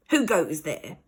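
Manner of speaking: a lightly voiced stern aunt; demanding aunt. Aunt Greta confronting intruders, stern. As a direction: challenge the intruders. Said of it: "demanded a voice".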